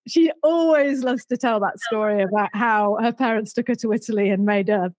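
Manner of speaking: ordinary delivery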